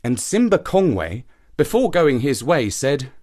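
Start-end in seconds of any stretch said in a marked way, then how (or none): none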